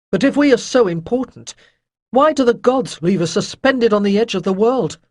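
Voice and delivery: sneering. A narrator's reading. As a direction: throw the question out with scorn. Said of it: "face was contorted in a sneer".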